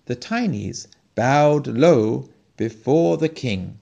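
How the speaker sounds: ordinary delivery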